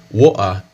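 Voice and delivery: woa with the T removed; dropped T. Shown as a demonstration. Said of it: "tira completamente o som do T".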